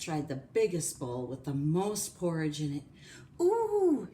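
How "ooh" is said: high, loud "ooh"; pained ooh. Acted. Goldilocks tasting the biggest bowl, pained ooh. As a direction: yelp an ooh at a scalding taste. Goldilocks burns on hot porridge.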